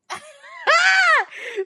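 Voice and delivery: loud burst of laughter. Real, not acted.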